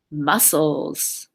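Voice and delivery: ordinary talk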